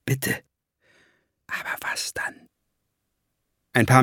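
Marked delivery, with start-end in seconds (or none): 0.1-0.4 soft, pleading word; hushed entreaty; whispering. A narrator's reading. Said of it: "flüsterte sie ihm zu". 1.5-2.4 very soft, voiceless whisper; hushed, whispered question. A narrator's reading. Whispered reply to the plea.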